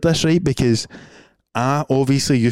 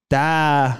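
a hint of a confused baby, pitched up; 4 semitones higher, slower than usual. Bringing the imagined hotel baby to life, lightly pitched-up baby. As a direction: play the wee baby waking in the hotel. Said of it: "Let's bring it to life".

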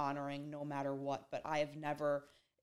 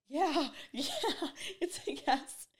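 high, laughing eager yes; high giddy yes with a laugh; 10 semitones higher than usual. Retold or imitated. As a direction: laugh through the yes, delighted. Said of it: "And I'm like".

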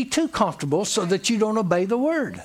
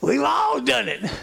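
higher voice, still shaking with laughter; laughing admission; 4 semitones higher than usual. Real, not acted. Preacher admits shared silliness to congregation, laughing. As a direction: confess it while recovering from a big laugh.

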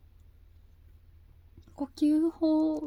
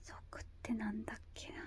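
whispering to herself; 4 semitones lower, 17 dB quieter, much faster than usual. Real, not acted. Muttering to herself.

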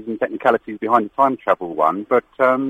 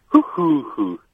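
London pigeon coo; 4 semitones higher, much slower than usual. Shown as a demonstration. Host asks for the London pigeon, caller coos it higher. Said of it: "Do the London one again".

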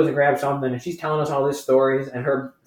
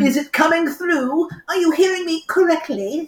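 much higher put-on squeaky check; mock high voice. Host teasing cohost about the mic, much higher mock voice. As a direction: ask in a silly high voice.

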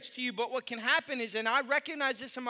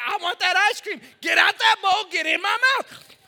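loud, higher hammed-up craving; mock greedy shout; 7 semitones higher, 10 dB louder than usual. Pastor acting out his ice cream craving, hammed-up shout. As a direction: bark it like a kid who wants ice cream. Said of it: "I'm like".

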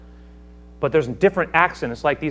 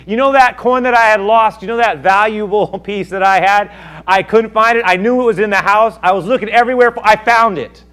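higher, louder, bubbling over with news; a woman gushing to her neighbors, a bit higher and louder; excited woman; 9 semitones higher, 12 dB louder than usual. Acted. Neighbor woman gushing about her found coin, excited.

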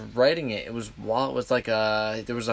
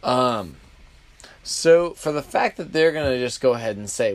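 ordinary talk

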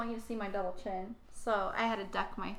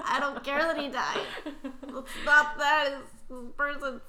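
words laughed through, louder at the end; laughing; 5 semitones higher, 7 dB louder than usual. Real, not acted. Co-host laughing about a movie death. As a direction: talk through the giggles.

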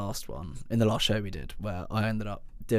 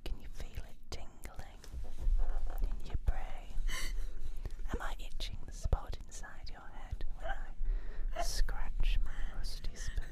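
creepy ASMR whisper; 6 semitones higher than usual. Acted. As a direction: whisper like an eerie ASMR host. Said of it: "Welcome to Salad Fingers A S M R".

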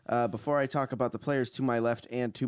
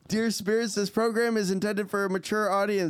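spooky announcer disclaimer; 7 semitones higher than usual. Acted. Spooky host disclaimer to ghouls.

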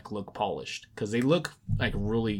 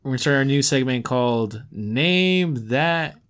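a louder, slow showman announcement; announcing the new Name That Game segment, slow and grand; 2 semitones higher, 5 dB louder, slower than usual. Acted. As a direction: drag out the game-show host intro.

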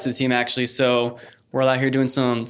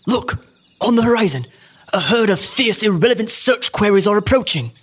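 higher, urgent wildlife narrator; excited nature documentary; 6 semitones higher than usual. Acted. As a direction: sound the alarm like a naturalist. Documentary narrator spots danger.

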